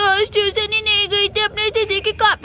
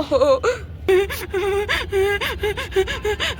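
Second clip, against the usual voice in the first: villain laugh. Acted.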